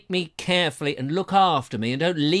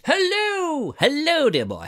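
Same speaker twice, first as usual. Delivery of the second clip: mock grand greeting; 10 semitones higher, slower than usual. Reading a viewer's greeting in character.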